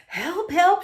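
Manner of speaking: high, loud panicked cry; shrieking for help. A narrator's reading. Frightened lady spotting a sea monster, high shrieks. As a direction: shriek it in terror. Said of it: "she shrieked".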